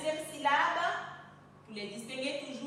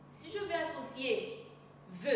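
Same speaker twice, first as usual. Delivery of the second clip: ordinary talk, nothing marked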